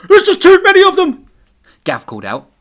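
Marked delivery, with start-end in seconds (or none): 0.1-1.3 high, loud panicked shout. Acted. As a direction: call out over the zombie horde. Gav calling out amid zombies.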